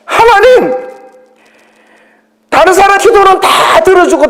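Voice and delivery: high, pleading protest to God; defiant complaint to God. Retold or imitated. Believer complaining to God, high defiant plea. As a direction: talk back to God in a high indignant plea. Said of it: "막 대드는 거야".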